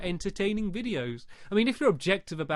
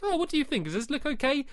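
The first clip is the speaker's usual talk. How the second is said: high questioning voice; 9 semitones higher, faster than usual. Retold or imitated. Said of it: "I might go like".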